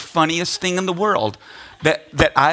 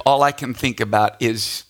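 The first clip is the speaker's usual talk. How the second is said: ordinary talk, nothing marked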